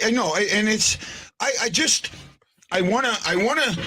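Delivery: normal talking voice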